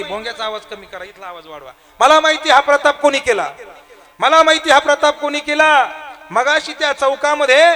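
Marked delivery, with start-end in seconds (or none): 2.0-7.6 high, loud repeated accusation; shouting. Retold or imitated. Widow accuses the varkari before the boys, loud. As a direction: keep crying it out high and loud. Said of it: "ती ओरडायला लागली".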